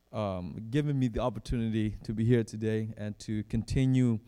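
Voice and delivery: ordinary talk, nothing marked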